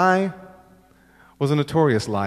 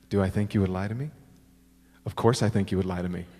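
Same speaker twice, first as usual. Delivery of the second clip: deadpan father; 5 semitones lower, 4 dB quieter, faster than usual. Pretend. Imagined father's deadpan answer in daydream.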